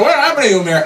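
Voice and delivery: high, shocked cry; horrified. Retold or imitated. George finds Mary in a sensible dress, shocked and high. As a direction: recoil at the sight of her.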